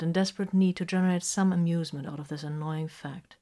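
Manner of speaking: ordinary delivery, nothing marked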